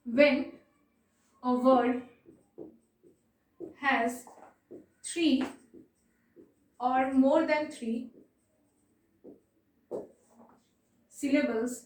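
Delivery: word by word with long pauses; measured note-taking pace; slow dictation. Real, not acted. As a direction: dictate slowly, pausing after each word or two.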